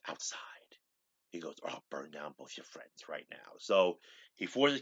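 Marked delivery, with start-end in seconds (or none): 0.1-0.4 low, near-whispered order; hushed, threatening command; quietly furious. Retold or imitated. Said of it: "Captain Tracy's furious". 1.6-3.5 low, soft threat; hushed, threatening undertone; quietly furious. Retold or imitated. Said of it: "Captain Tracy's furious".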